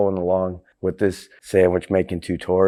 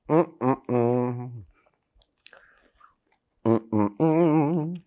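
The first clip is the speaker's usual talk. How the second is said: savoring hum; 2 semitones higher, 7 dB quieter than usual. Real, not acted. Savoring the sandwich.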